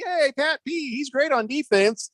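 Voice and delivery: high-pitched mock fan praise; hyped-up imitation of fans; imitating cheering fans. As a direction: pitch it way up like an excited crowd. Voices cheering fans' reaction.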